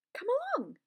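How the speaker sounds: loud, high-pitched hearty call. A narrator's reading. Scarecrow welcomes the Tin Woodman along, heartily. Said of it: "said the Scarecrow heartily".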